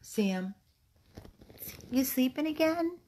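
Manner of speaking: high cooing question; sing-song coo. Real, not acted. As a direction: tease the dog gently. Baby talk to sleepy dog.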